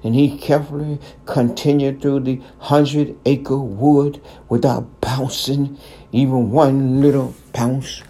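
ordinary delivery, nothing marked

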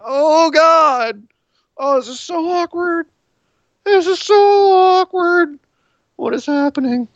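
high, drawn-out whine of awkwardness; mock cringing viewer. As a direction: squirm aloud in a high, hammed-up voice. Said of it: "we're just sitting there going".

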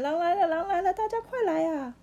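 loud, high panicked cry for help; frightened shout. A narrator's reading. Shepherd boy begging villagers for help, panicked shout. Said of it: "吓得大喊".

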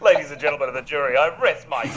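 fast, grand closing to the jury with a laugh; mock courtroom lawyer. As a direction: play a hammy lawyer resting his case.

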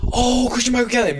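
high-pitched retold exclamation; excited recognition. Storyteller replays reacting to the name in the car, excited.

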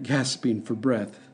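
ordinary delivery, nothing marked

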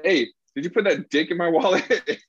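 high mock confrontation cracking into laughter; laughing accusation. Asking the suspected prankster about the wallet, laughing accusation.

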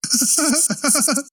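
high burst of laughter. Real, not acted.

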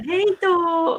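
sing-song goodbye shouted over her shoulder; cheery yelled goodbye; calling out. Retold or imitated. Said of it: "hör man Bo bara ropar".

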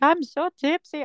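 high, drawn-out tipsy mum impression; slow it down and pitch up, mock tipsy. Daughter taking the piss out of her mum, tipsy and high-pitched. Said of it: "two glasses and be like".

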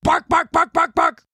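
loud high barks; dog barking. A narrator's reading. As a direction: bark it out like a dog. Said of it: "all the human heard was".

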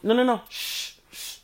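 mimicked shushing, high quick noes. Teacher silences the helper at practice, shushing. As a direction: cut them off with drawn hushes. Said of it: "when she shushed me".

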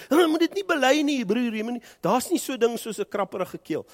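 high, loud, fast mock rebuke; mock preachy denial. Preacher mocking a believer who denies a scratchy throat, high and loud. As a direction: lampoon the super-spiritual brother scolding. Mimics over-spiritual denial of illness.

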